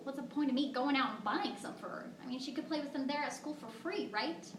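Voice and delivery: ordinary talk, nothing marked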